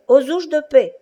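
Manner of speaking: high, loud repeated cry for help; shouting cobbler. Acted. Old cobbler calling from his shop, high loud shout. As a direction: call out again from the shop. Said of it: "Un vieux cordonnier juif criait".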